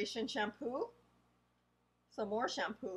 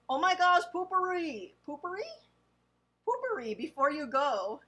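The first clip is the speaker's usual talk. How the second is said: surprised; 6 semitones higher, 4 dB louder than usual. Real, not acted. Amused surprise at funny brand name.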